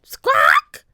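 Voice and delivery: very high, loud parrot screech; high loud squawk. A narrator's reading. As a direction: squawk like a bird.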